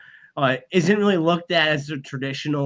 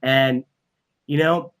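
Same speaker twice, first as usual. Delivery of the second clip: ordinary talk, nothing marked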